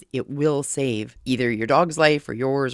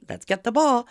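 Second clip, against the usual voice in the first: much higher, louder cheerful invitation; happy excited call; 8 semitones higher, 4 dB louder than usual. Retold or imitated. Owner luring the dogs apart with a ball, happy excited call. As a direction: sound like it's fetch time. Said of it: "I kept it excited, happy".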